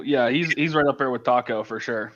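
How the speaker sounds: ordinary delivery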